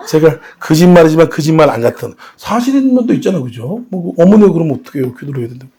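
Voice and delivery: ordinary talk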